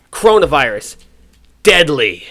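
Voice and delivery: loud dramatic headline. Retold or imitated. Escalated mock headline.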